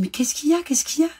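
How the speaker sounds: pleading to know what's wrong; alarmed mother. Retold or imitated. Mother begging her crying son to explain, alarmed.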